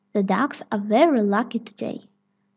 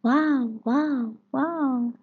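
distant echo; 3 semitones higher, much slower than usual. Acted. Peppa's echo coming back from the distance, fading. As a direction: sound like an echo in the mountains. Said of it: "Peppa hears in the distance".

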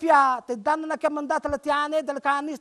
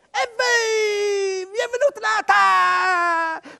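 high, loud, tearful cry of joy; excited crying shout; 6 semitones higher, 3 dB louder, slower than usual. Acted. Everyone announcing that Christmas has come, crying out. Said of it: "ognuno diceva".

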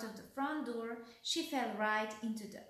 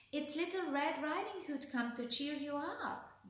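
lightly voiced little girl, pitched higher; 6 semitones higher than usual. A narrator's reading. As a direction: lift the voice for a young girl's reply. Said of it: "the little girl replied".

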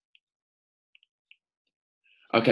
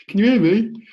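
high, loud mimic of a worried caller; imitating Claire; 11 semitones higher, 7 dB louder than usual. Coach mimicking Claire on the call, high and loud. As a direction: copy her anxious call. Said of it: "she's like".

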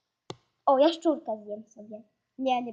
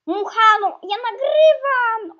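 loud, high, drawn-out protest; exasperated shout; 8 semitones higher, 9 dB louder, slower than usual. Real, not acted. Player scolds the fly while recording, exasperated shout. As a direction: complain loudly, stretching the words.

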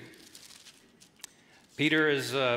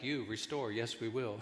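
lightly voiced Yoda, words inverted; a hint of wise old Yoda; 7 semitones lower, 10 dB quieter than usual. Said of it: "he's like Yoda to all us pastors".

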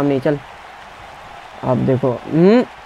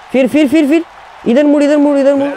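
frantic shouted game commands; frantic urging; 10 semitones higher than usual. Real, not acted. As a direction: yell at the game to turn round. Urging character to turn.

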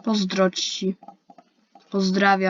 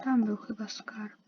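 whisper; 8 dB quieter than usual. Real, not acted. Secretive aside about treasure.

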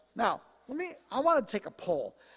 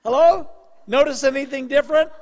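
excited calling out; 8 semitones higher, 13 dB louder than usual. Retold or imitated. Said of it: "I'm going".

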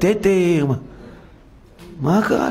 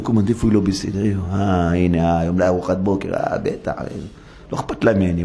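much lower, grumbling under the breath; low, muttering petty husband; 11 semitones lower than usual. Retold or imitated. Preacher imitates a husband searching for his wife's faults, low petty grumble. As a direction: nitpick like a husband hunting for her faults.

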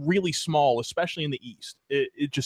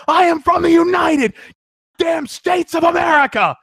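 furious patriotic shout; 14 semitones higher, 10 dB louder, slower than usual. Retold or imitated. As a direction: yell it slow and loud, pulling out the passport. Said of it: "I look up to her, and I go".

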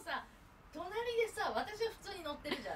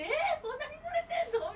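mock surprise; 4 dB louder than usual. Friend retells her amazement while laughing. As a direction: draw out the えー in disbelief.

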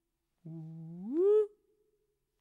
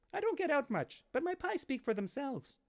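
much higher, small and timid reply; meek pie maker; 11 semitones higher than usual. Acted. Pie maker answering the barbarian chief, high and timid. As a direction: answer meekly, pitched way up.

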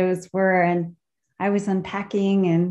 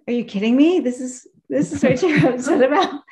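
higher, louder, laughing through the words; amused laughing; 5 semitones higher, 4 dB louder than usual. Retold or imitated. Ravi to the worried guest over a burned toilet, laughing. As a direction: play his gentle disbelief, breaking into a laugh. Ravi's amused reply, voiced.

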